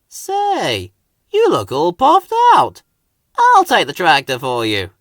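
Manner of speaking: Hank voice. Acted. Hank offers help.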